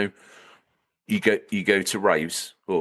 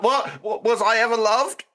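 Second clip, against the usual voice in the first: high-pitched, hurt mascot voice; hammed-up high lament; Spyro; 12 semitones higher than usual. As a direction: plead in a squeaky, wounded character voice. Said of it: "You got Spyro like that".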